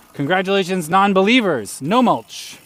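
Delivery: loud, high victory call; triumphant shout. Real, not acted. Triumphant announcement to chat.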